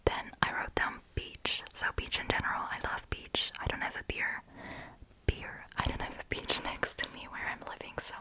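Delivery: ordinary talk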